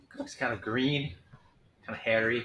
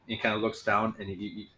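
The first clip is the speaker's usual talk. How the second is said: ordinary talk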